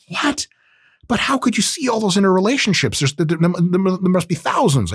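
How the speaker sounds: high-pitched, incredulous questioning; mock astonishment. Acting out an astonished listener's reply.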